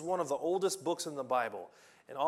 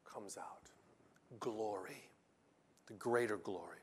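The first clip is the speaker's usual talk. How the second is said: whispered; 6 semitones lower, 8 dB quieter than usual. Real, not acted. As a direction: drop to a breathy whisper for the last words. Sermon point, dropped to whisper.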